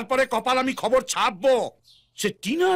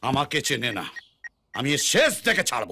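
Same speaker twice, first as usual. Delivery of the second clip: low, brooding threat; menacing vow; 12 semitones lower than usual. Acted. Angry father vowing to see this through, low and menacing. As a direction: drop the pitch right down and threaten. Vows revenge to himself.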